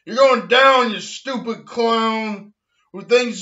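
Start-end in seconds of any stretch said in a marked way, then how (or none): 0.1-0.9 loud repeated threat; mock threat. Threatening Big Bird once more, loud. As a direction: say it again, loud.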